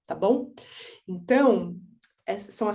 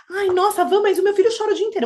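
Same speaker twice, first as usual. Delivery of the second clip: high, fast, exasperated protest; a mother blurting her objection, fast and high; complaining mother; 9 semitones higher, 5 dB louder, faster than usual. Retold or imitated. A mother protesting to the teacher, high, fast and exasperated.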